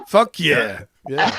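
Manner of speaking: brash American cheer; jokey American accent; mock American. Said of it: "We just went from a U K accent to America".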